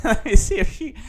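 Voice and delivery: high, loud words through a laugh. Real, not acted. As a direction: crack up while acting out the quote. Laughs while quoting own scheming.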